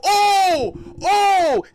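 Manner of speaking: high, loud, stretched-out exclamations; loud drawn-out ohs. Retold or imitated. Storyteller replaying his uproar, loud drawn-out ohs. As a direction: wail each oh up high.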